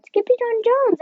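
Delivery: high loud cry of his name; shout. A narrator's reading. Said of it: "everyone cried".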